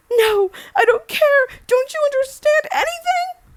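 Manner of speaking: loud, very high, tearful shout; distraught outburst. Acted. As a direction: lash out at your daughter, near tears. Mother shouts back in distress.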